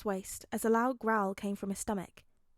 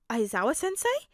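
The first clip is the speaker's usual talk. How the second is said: high, loud startled call of a name; surprised; 5 semitones higher, 6 dB louder than usual. A narrator's reading. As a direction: blurt the name in shock. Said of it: "Midoriya said in surprise".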